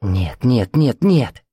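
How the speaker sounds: understated tearful plea, rising on the last no; a touch of held-back tears; barely holding it together. A narrator's reading. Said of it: "прошептала она, едва сдерживая слёзы".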